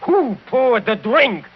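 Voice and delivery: high, driving, pressing each question; heated accusation. Acted. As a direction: build the case, slowing on the last. Count heatedly lists evidence.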